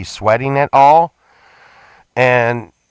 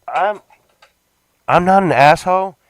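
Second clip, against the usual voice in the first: ordinary talk, nothing marked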